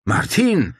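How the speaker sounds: Paul startled to see Martin, blurted high; startled exclamation. Acted. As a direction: blurt it out in shock. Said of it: "stieß Paul hervor".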